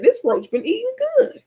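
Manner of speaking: very high amazed exclamation. Retold or imitated. Seeing a giant roach, squeaky amazed exclamation. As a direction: marvel at the huge roach. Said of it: "I said".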